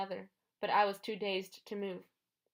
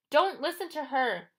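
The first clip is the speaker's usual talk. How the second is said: loud, higher warning shout; 6 semitones higher, 7 dB louder than usual. A narrator's reading. Annabeth warning Percy from the statues, shouting. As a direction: shout it from hiding. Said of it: "Annabeth's voice shouted".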